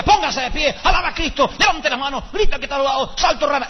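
lampoon the hyped-up worship leader; mock fiery preacher. Mocking the other pastor who ordered everyone up.

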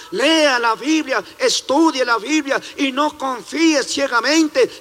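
urgent preaching with stressed "van" and "lea". Acted. Preacher urging radio listeners to read the Bible, fervent preaching. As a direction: warn and plead with the listeners.

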